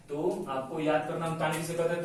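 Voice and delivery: ordinary talk, nothing marked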